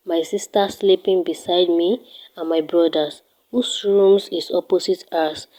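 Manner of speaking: ordinary talk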